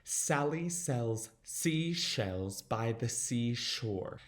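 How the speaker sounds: slow "Sally sells seashells by the seashore" with prominent S sounds; S's standing out. Shown as a demonstration. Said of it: "make those S's stand out".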